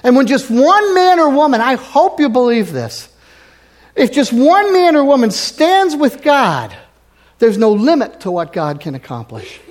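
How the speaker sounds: fervent preaching. Acted. Pastor to congregation, preaching at full force. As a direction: preach it out to the whole church.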